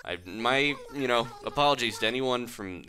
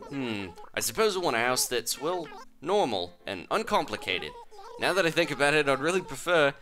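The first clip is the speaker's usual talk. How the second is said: slightly raised mock Australian voice; bad Australian accent; 4 semitones higher than usual. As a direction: play the client with a poorly faked Australian accent. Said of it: "This is a bad accent".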